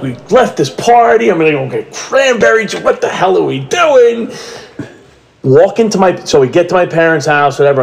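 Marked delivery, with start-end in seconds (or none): 0.0-4.3 high, indignant mock complaint; annoyed outburst. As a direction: vent the annoyance, pitched way up. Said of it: "So I'm like".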